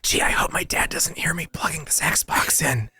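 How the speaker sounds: put-on sneaky kid whisper. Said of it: "I need Foley for um my son trying to install an Xbox".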